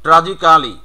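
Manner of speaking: full "-ka-li" ending on ট্র্যাজিক্যালি; local mispronunciation. Shown as a demonstration. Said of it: "not ট্র্যাজিক্যালি".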